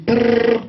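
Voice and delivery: rolled trill for a ringing phone; imitated phone ring.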